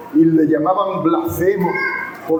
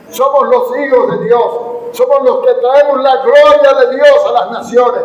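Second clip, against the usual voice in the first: raised, building voice of public supplication; fervent prayer; 5 semitones higher, 5 dB louder than usual. Real, not acted. Preacher asking God to keep the church faithful, fervent prayer. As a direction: plead and proclaim with mounting force.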